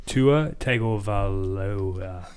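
"Tua Tagovailoa" dragged out very slowly; very slow stumble. Real, not acted. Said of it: "I looked like I had a seizure there".

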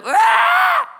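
very high, loud infant scream; sudden piercing mock baby cry; imitated baby wail. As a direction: shriek like a baby waking up. Imitates his baby's sudden crying.

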